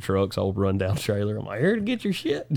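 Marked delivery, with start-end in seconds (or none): 1.6-2.5 high-pitched call of a hired crew; mock cheerful arrival call. Showing up at the rich client's house, high mock call. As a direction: sing it out much higher, like crashing the party. Rolling up in beat-up trucks.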